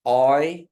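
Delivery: loud clear oi; teacher drills the oi sound; Kiowa oi. Shown as a demonstration. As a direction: call oi out for the class. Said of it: "but it's".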